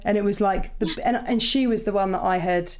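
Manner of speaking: ordinary delivery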